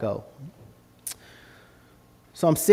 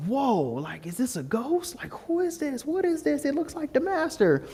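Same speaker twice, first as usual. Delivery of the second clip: high, hushed, mock-spooked questioning; spooked wonder; 10 semitones higher, 5 dB quieter than usual. Disciples see someone walking on water, spooked. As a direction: climb into a soft, high squeak of disbelief.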